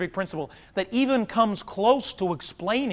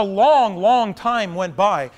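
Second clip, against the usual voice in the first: long, long stretched slowly; drawn-out long; 5 dB louder, much slower than usual. Shown as a demonstration. Telling the audience how long the prayer ran, slowly drawn out. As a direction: drag out the long wait. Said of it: "stretching the wait".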